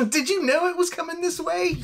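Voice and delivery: high-pitched question through laughter; amused. Real, not acted. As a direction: laugh while asking it. Joking question while laughing.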